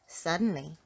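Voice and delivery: usual speaking voice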